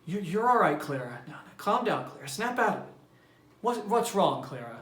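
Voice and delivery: calm her down, a bit high-pitched; mild soothing friend. A narrator's reading. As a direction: reader voicing a flustered hostess. Mildred calming crying Clara.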